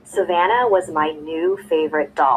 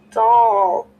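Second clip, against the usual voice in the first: put-on mumbling baby; 4 semitones higher, 6 dB louder than usual. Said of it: "she mumbled".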